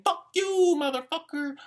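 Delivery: hammed-up road-rage woman screaming; imitating a woman driver cursing out of her car; mock angry woman driver. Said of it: "women ... saying".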